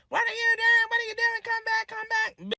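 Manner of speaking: very high frantic mock voice; imitating frantic girls. Friends texting her to come back, shrill frantic mock plea. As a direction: squeal it like panicked friends.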